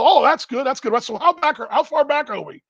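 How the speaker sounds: high put-on voice of an old ballplayer; Lou Piniella. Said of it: "It's Lou Piniella".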